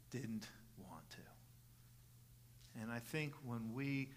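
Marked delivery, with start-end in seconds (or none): 0.1-2.8 near-whisper, very slow; slow, whispered admission; hushed confession. Real, not acted. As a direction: let the voice sink almost to nothing. Hushed confession after forceful preaching.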